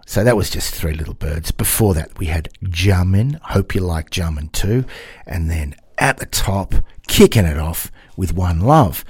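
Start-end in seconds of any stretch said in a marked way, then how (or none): none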